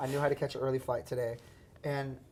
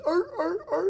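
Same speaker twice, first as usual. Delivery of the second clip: high barking seal calls; seal impression. Shown as a demonstration. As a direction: bark like a seal. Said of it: "That's my seal impression".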